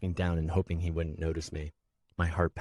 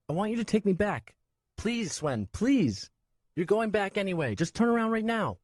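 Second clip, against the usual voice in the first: put-on high boy's voice, begging; pleading child; 4 dB louder than usual. Nico begging Swen to turn back, high pleading child. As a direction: beg him to take you home.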